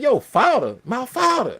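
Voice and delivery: high, loud mimicry of "father"; imitated New Orleans pronunciation. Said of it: "lost ... on the word father".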